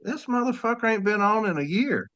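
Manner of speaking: incredulous aside. Retold or imitated. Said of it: "I was like".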